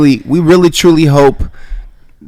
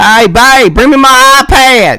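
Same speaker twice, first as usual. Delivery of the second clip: higher, louder southern drawl impression of his wife; imitating her southern accent; 12 semitones higher, 7 dB louder, slower than usual. Husband mimics wife's southern accent asking for her iPad. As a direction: call out for the iPad in a mock southern voice. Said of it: "She be like".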